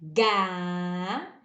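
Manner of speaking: "Ga" with hard g, vowel held long; slow teaching syllable "Ga"; syllable demo. Shown as a demonstration. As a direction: draw out the a of "Ga". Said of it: "quando nós queremos o som".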